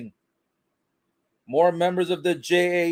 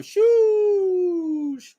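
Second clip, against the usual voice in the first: mock shush; 14 semitones higher than usual. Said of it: "shushing the fans".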